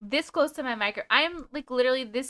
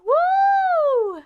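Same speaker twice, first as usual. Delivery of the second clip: high, loud whoop of delight; excited whoop; 6 dB louder than usual. Real, not acted. Streamer cheering a viewer who guessed right, a high excited whoop. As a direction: cheer the viewer on with a big woo.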